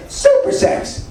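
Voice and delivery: very high breathy squeak, whispered; squeaky old lady. Retold or imitated. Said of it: "she goes".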